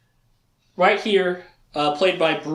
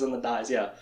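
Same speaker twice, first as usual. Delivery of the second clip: ordinary talk, nothing marked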